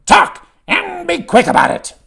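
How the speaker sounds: loud, high, growled goblin order; angry goblin shout with a growl. A narrator's reading. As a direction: growl out an impatient command at full volume. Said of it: "the adult goblin growls".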